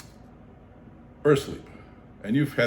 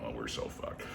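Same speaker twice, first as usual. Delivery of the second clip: much softer, lower doom; resigned mutter; 12 semitones lower, 15 dB quieter than usual. Retold or imitated. Realizing the ants surround them, softly resigned. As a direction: sink into hopelessness.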